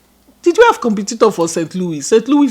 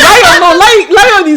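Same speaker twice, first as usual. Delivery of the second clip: excited; 9 semitones higher, 6 dB louder, much slower than usual. Real, not acted. Excited correction.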